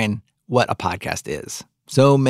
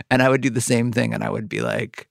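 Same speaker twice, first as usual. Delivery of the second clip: normal talking voice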